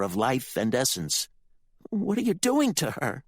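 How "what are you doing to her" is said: much higher horrified question; appalled at what he sees; shocked boy. Acted. Ricky horrified at Candy's torture.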